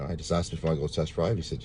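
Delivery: ordinary talk, nothing marked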